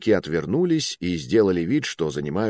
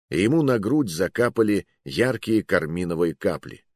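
ordinary delivery, nothing marked

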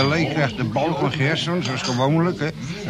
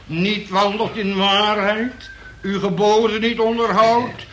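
preaching at full force; fiery prayer; 5 semitones higher than usual. Acted. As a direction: call down wrath like a preacher. Prays aloud at the group.

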